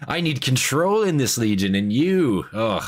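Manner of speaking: high, sneering villain voice; mocking Erebus voice. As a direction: sneer it like a smug traitor. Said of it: "he's just saying".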